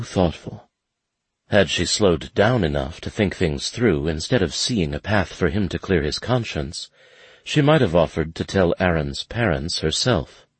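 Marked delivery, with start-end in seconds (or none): none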